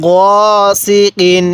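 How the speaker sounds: "গসিকিন" read slowly, vowel held one count; one alif elongation. Shown as a demonstration. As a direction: spell out "গসিকিন" slowly with a short pull. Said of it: "এরকমভাবে শিখব". ("গসিকিন" এক আলিফ টানে ধীরে)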